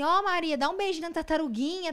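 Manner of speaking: high, sing-song coaxing; coaxing a toddler. Retold or imitated. (voz de falar com criança, pedindo beijinho)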